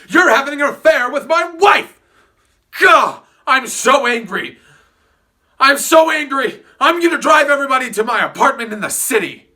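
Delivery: loud, over-the-top husband fury; hammed-up jealous rage. Tom accusing Gatsby of the affair, hammed-up yelling. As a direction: bellow like a cartoon cuckold.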